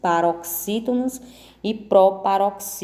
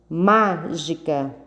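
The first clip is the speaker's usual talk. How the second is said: "Mágica" slow, with MA drawn out; slow teaching "Mágica", stress on MA; stressed first syllable; slower than usual. Shown as a demonstration. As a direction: hold the first syllable of "Mágica", then finish. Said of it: "é o ma".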